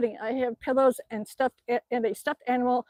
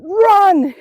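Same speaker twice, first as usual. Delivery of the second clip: loud, high yell to flee; urgent shout; 8 semitones higher, 12 dB louder than usual. Retold or imitated. Uncle telling niece to flee a moose, urgent shout. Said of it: "And my uncle said".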